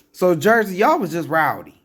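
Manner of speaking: loud, high exasperated outburst; high-pitched scolding cry; exasperated shout. Real, not acted. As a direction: yell it at Jersey in disbelief. Exasperated outburst about Jersey.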